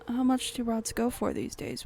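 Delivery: ordinary delivery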